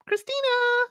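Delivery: very high, drawn-out name call; imitated yell. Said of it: "her tone of voice when she's yelling out that name".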